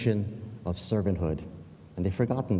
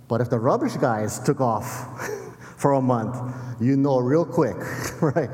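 amused, laughing; 2 semitones higher than usual. Real, not acted. Preacher joking about missing rubbish guys, laughing. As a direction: let real laughs break into the point.

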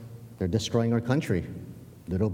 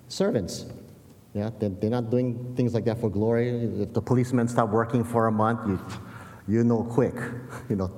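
normal talking voice

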